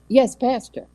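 ordinary talk

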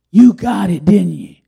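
loud, eager knowing question; excited question. Retold or imitated. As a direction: she already knows, ask with delight. Wife's excited question in car.

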